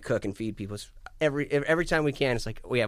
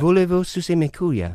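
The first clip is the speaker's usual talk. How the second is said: higher, louder, clumsy French; bad French accent; 5 semitones higher, 6 dB louder than usual. Shown as a demonstration. Showing off his few French phrases, bad French accent. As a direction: ask it in deliberately showy, poor French. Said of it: "my French pronunciation sucks".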